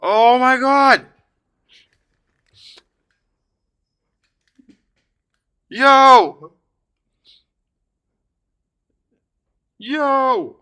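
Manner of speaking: high, drawn-out cries of disbelief; awestruck excitement. Real, not acted. Collector reacting to a rare pull, awestruck and high. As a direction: stretch it out high, blown away.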